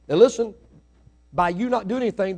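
ordinary talk

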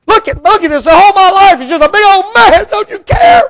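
high, loud, fast exasperated complaint rising to a shout; mock-frustrated wail climbing to a yell; frustrated prayer. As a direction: act out a fed-up believer venting to God. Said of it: "You ever got in your prayer time and say".

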